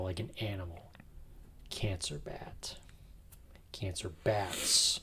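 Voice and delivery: hushed eerie whisper, slowing down. Acted. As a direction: breathe it like a ghost story. Spooky musing on band name.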